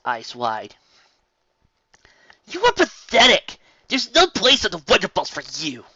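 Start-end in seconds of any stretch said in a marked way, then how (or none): 2.5-5.9 high, loud contemptuous voice; scornful Spitfire. Acted. Spitfire shaming Rainbow Dash, loud scornful voice. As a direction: spit out the insult. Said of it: "Spitfire said".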